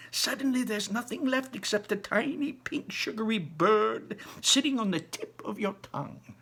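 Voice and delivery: put-on high, lively old man voice; a grandpa bursting with wonder; old grandfather. Grandpa Joe dazzling Charlie with Wonka's inventions, high and lively.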